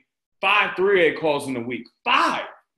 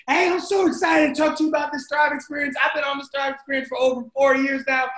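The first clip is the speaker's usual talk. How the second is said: high, fast, near-shouting with excitement; passionate excitement; 6 semitones higher, faster than usual. Shown as a demonstration. Recording a Messenger voice note to a prospect, bursting with excitement. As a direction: gush like you can't wait to share it. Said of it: "They hear your passion".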